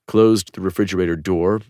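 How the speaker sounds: plain reading voice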